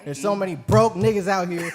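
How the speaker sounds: fired-up and loud, laughing at the end; heated rant. Real, not acted. Ranting about being broke.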